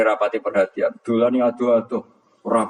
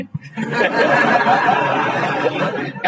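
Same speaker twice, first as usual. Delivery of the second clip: laughing. Real, not acted. Preacher laughs at his story.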